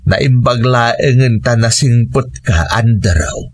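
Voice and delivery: ordinary delivery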